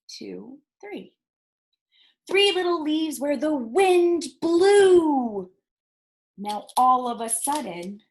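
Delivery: lively, sing-song read-aloud for little ones; reading to children. Acted. Librarian reading a counting rhyme to children, lively read-aloud. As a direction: read it like story time, drawing kids into the count.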